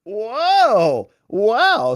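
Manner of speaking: high, loud startled whoa; loud, high gasp of surprise at a clip; surprised whoa. Real, not acted. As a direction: react as if caught off guard. Reacting to a played clip.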